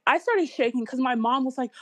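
usual speaking voice

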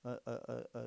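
ordinary talk

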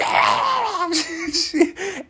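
high, loud wailing cry; banshee wail. Retold or imitated. Tracy charging Terry, mock banshee wail. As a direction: let out a high banshee shriek. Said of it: "goes banshee".

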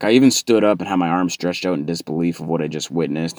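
ordinary talk, nothing marked

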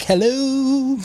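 high, put-on drunken hello; drunk phone greeting. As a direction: mimic a tipsy woman answering her shoe like a phone. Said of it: "brings her shit up to her ear".

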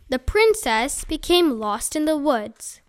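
usual speaking voice

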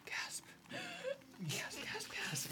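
very soft, breath-only mock gasps; hushed crowd reaction; whispered crowd gasps. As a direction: whisper it like shocked onlookers. Game master voices gasping onlookers.

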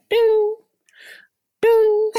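high chime-like mock ding; laptop ding. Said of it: "it will continuously go like".

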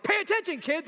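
high, loud mock bark at a kid; a hammed-up teacher telling a distracted kid off; gruff scolding adult. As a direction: snap it like an impatient teacher.

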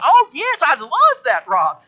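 put-on high, loud, gushing old-lady voice; delighted old lady. Preacher voicing Tony accepting the study, high and gushing. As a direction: gush with delight like a sweet grandma.

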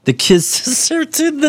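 high, slow teasing echo through a laugh; mocking. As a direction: tease him by parroting it. Mocking the friend's line.

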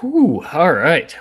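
bracing for a big question; whew before answering. Real, not acted. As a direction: exhale hard, then ease into it. Asked big question, blows out breath.

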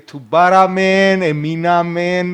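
loud, slow, stunned exclamation; recalling being stunned by the crash; shocked. Retold or imitated. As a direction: stretch the words out in disbelief.

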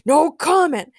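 shouted. A narrator's reading. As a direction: call it out as a curt refusal. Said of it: "Frankencastle shouted".

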